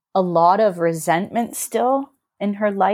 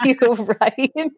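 words breaking into a real laugh; 5 semitones higher than usual. Real, not acted. Proud grandchild telling a friend, laughing. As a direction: let the laugh lift the last word.

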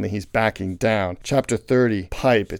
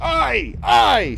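high, loud shouted assent; rousing sailors' cry; crew's shout; 5 dB louder than usual. A narrator's reading. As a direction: shout back as an eager crew. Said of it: "shouted the harpooneers and seamen".

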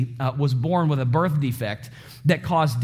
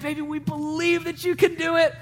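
encouraging parent; 10 semitones higher than usual. Retold or imitated. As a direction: coax a baby's first steps, voice pitched way up. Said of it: "You know, we'd say that".

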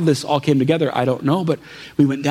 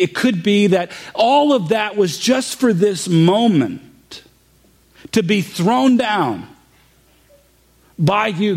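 raised, fervent preaching voice, slowing on key words; impassioned preaching; 6 semitones higher than usual. Acted. Pastor tells the congregation his story, in fervent, slowing preaching. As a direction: lift the room as the pastor drives home his point.

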